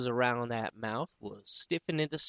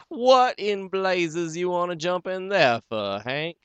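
higher, louder teasing holler; mocking laughter; 8 semitones higher, 6 dB louder than usual. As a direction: jeer at him down the hole. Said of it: "he laughs".

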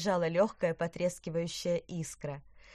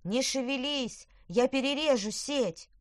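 high urgent little witch; 7 semitones higher, 3 dB louder than usual. A narrator's reading. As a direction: reassure the trapped fish urgently. Crab witch frees trapped carp.

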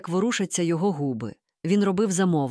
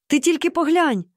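high, louder cry of amazement; exclaiming; 8 semitones higher, 5 dB louder than usual. A narrator's reading. Mom sees him take an umbrella, exclaiming. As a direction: exclaim it in astonishment, pitched well up. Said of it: "вигукнула мама".

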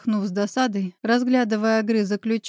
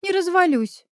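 much higher, not quite convincing; unsure bravado; 8 semitones higher than usual. A narrator's reading. Olya insists she'll cope alone, shaky high bravado. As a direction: fake confidence you don't feel. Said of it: "не очень уверенно сообщила Оля".

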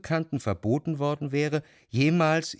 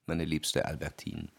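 lower, softer address; 7 semitones lower, 6 dB quieter than usual. Acted. Narrator addresses his dear Albertine, lower and softer. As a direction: lower and softer, gently courting.

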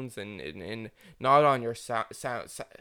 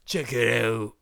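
loud mock-Australian assistant; Australian accent; 5 dB louder, slower than usual. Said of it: "It's talking in Australian accent".

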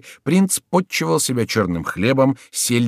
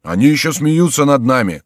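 loud, raised outcry; indignant shouting; 5 semitones higher, 6 dB louder than usual. A narrator's reading. Townsfolk about the mocking gentlemen, shouting indignantly. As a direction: shout like an affronted mob. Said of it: "закричали граждане".

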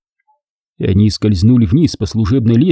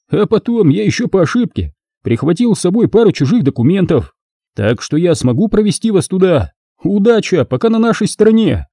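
higher, lively thief's voice; grinning rogue; 8 semitones higher than usual. A narrator's reading. Thief boasting of the documents he took, grinning. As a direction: speak through a grin, pitched up. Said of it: "Дэн ухмыльнулся".

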